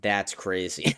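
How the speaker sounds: ordinary delivery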